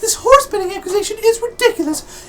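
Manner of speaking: very high, thin character voice; high squeaky character voice. Acted. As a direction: pitch way up for the character. Character voice in game scene.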